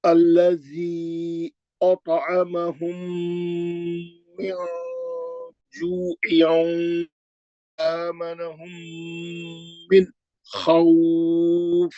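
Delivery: very slow, stretched tajweed chant; recitation. Shown as a demonstration. Teacher recites again despite a sore throat, slow. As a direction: chant the verse slowly, letting vowels ring. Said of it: "फिर पढ़ लो".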